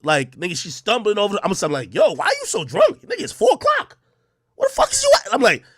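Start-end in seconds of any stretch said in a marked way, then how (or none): 1.9-5.3 high-pitched, incredulous retold rant; outraged demand. Boyfriend confronting his drunk girlfriend by phone, retold outrage. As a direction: demand answers in a high, exasperated voice.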